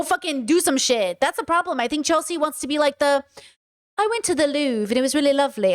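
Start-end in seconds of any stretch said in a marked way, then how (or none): 4.0-5.7 a prim British accent, mocking; mock-refined British accent; posh British. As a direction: play a genteel British tourist. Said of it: "I don't know why I made her British".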